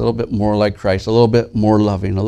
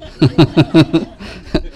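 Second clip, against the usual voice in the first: laugh; 6 semitones higher than usual. Real, not acted. Laughs at the mix-up.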